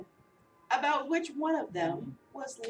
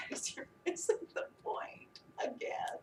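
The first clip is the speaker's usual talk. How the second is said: slow, whispered disappointed Jesus; a soft, slow whisper of disappointment; gentle whisper; 5 semitones higher, 7 dB quieter, slower than usual. Acted. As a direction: whisper it like a tired teacher.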